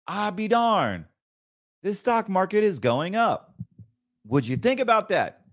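high sarcastic disbelief; mock surprise. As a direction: act amazed, dripping with sarcasm.

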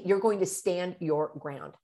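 ordinary delivery

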